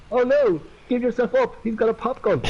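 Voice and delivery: mock alarm; mock panicked cops shouting. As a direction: play the scared criminals yelling. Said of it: "they're gonna shout".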